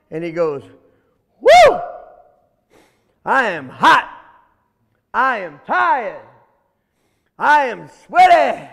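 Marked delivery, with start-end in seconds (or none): 1.4-1.8 high, loud "woo"; loud whoop. Acted. Buffalo halts hot at the pond, loud whoop. 3.2-8.7 loud, spaced-out buffalo declarations; big buffalo declaring. Acted. Buffalo worn out from running, loud declaring. Overheated buffalo announces its state.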